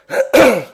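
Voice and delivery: sick, rough cough; real cough. Real, not acted. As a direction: cough from a sore throat. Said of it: "désolé si je tousse un peu". (toux rauque)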